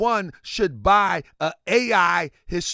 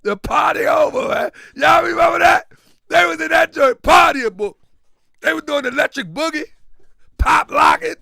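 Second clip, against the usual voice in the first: excited shouting; 8 semitones higher, 8 dB louder than usual. Real, not acted. Hyped recollection shouting.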